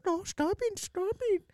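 very high mock wife voice; imitating a mortified wife; embarrassed wife. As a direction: plead in a high put-on woman's voice. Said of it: "his wife was, like, telling him".

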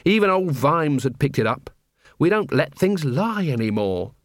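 ordinary delivery

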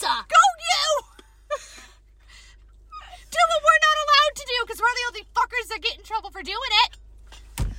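cheering the rebel on; excited cheering. Real, not acted. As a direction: egg him on with glee. Cheering coworker on.

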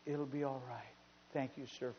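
put-on low, hushed voice of a hurt child; soft retold reassurance. Beaten little boy reassures the man who helped him, quiet. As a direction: imitate the boy quietly thanking his rescuer.

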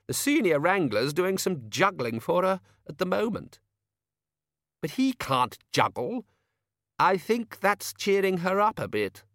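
high, slow objecting academic; high-pitched donnish quibbling; peevish Chair of studies. Acted. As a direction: protest in a high, drawn-out character voice.

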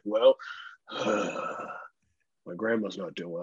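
mock gloomy. Driver imitating the shopper's sudden gloomy grandma news with a sigh. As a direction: sag into a sighing, downbeat reply. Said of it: "And then immediately he's like".

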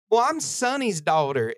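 high imitation of country accent. Nurse explaining how she knows the family, imitated country accent. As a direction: lightly mimic the country nurse introducing herself. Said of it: "in the most country accent".

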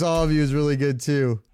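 ordinary delivery

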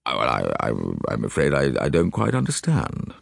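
low, stammering English lord; puzzled gentleman. A narrator's reading. Gentleman asked what he saw, puzzled. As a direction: hesitate and restart, baffled, in a low voice. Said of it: "The gentleman looked rather puzzled".

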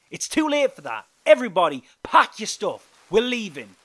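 loud, high-pitched command to everyone; shouted order. A narrator's reading. Joe ordering everybody to pack and leave, shouting. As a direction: shout it out to rally the whole camp. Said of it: "he shouted out".

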